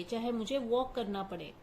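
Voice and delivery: ordinary talk, nothing marked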